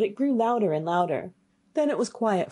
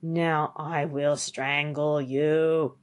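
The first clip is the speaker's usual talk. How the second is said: very slow, drawn-out ghoul voice; menacing dead man; 2 semitones lower, much slower than usual. Acted. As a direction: stretch the threat out ominously. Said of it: "got up and cried".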